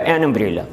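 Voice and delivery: امبریلا کو اَ کی آواز سے، یو کے بغیر; این امبریلا، شروع میں واول کی آواز; vowel start. Shown as a demonstration. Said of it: "اسے ہم امبریلا نہیں بولتے". (امبریلا اَ سے شروع)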